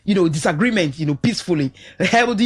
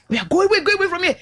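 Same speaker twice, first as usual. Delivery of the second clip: much higher, mock shooing call; shooing the kids; 10 semitones higher, faster than usual. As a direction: chase the kids off, pitched high. Aunt chasing the children away.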